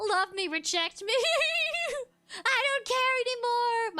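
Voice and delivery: high, loud hammed-up cry; melodramatic outburst. Joking to chat about rejection, melodramatic cry. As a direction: throw it out like a dramatic meltdown.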